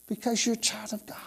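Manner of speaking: fast soft whisper. Real, not acted. Preacher whispering to the congregation, fast whisper. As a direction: whisper it as a blessing.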